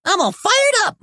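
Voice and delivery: high, bouncy catchphrase; eager pup. Acted. Marshall arriving at the town hall, eager and bright. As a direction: fire it off with gusto. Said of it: "Marshall said".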